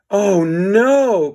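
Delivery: high, loud panicked cry; alarmed cry. A narrator's reading. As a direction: yelp it in alarm. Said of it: "cried George".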